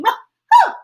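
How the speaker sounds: loud, high woo; party whoop for the class; high cheer. Acted. Cheering after song.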